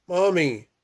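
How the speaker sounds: high, loud call to a mother who won't wake; child calling out. Acted. Small boy calling his sleeping mother, high and loud. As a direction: cry out for Mommy.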